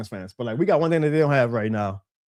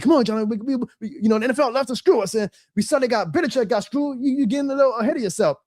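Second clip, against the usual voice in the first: high-pitched imitation of nervous Saints fans; mock worried fan; 9 semitones higher than usual. Saints fans warn him before the announcement, in a high mocking voice. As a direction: play the doubting fan, pitched up and fretful. Fans pushing back on speaker.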